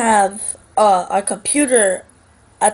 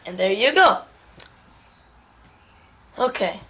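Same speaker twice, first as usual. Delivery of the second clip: usual speaking voice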